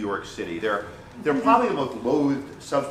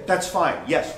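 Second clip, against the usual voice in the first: ordinary talk, nothing marked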